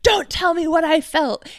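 high, loud mock shout; mad. Podcaster mimicking his retort to her worry, angry mock shout. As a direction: imitate a man yelling defensively. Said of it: "he's even mad about that".

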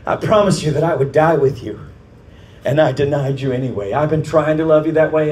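impassioned plea. Acted. Disciple confessing to Jesus that he failed him, impassioned plea. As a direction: plead it out, voice lifted and forceful.

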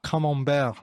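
Camembert in a French accent, "kuh-mom-BEHR". Shown as a demonstration. Correcting a friend on the cheese's name, French accent. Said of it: "developed a really good accent".

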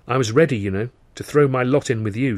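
ordinary delivery, nothing marked

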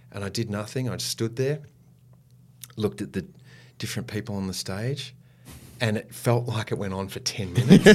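ordinary delivery

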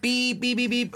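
high, held beep noises; string of high-pitched beeps; imitated censor bleep. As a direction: bleep out the swearing by voice. Imitates bleeps over swearing in story.

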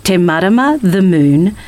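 ordinary delivery

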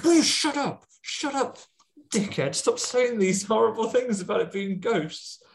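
hammed-up furious husband telling her to shut up; mock angry husband. Husband snapping at wife over ghosts.

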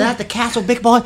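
high mock American voice; teasing fake American tourist; kidded-on American accent. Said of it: "we were kidding on the American".

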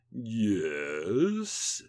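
long, drawn-out creepy yes. A narrator's reading. Rees-Mogg greeting the intruder, creepy and drawn out. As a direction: stretch the word out creepily.

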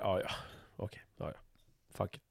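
mild, let-it-go mutter; just let it slide, resigned; resigned shrug. Retold or imitated. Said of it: "ett är bara så här". (uppgivet och släpper det)

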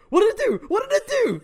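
high, loud frantic question; panicked scream. A narrator's reading. Reader voicing Rincewind with a note on his back, high panicked scream. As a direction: scream it out, pitch shooting up. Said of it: "screamed Rincewind".

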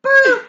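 shouted gunshot boom; loud boom. Retold or imitated. Imitated gunshot.

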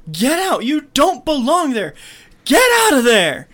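high, loud frantic yell. Retold or imitated. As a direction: scream at him to get out. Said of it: "she's just yelling at him".

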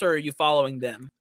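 ordinary talk, nothing marked

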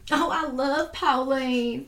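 slow, hammed-up Southern accent. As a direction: gush like a Southern neighbour, Southern accent. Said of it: "I love speaking in a Southern accent".

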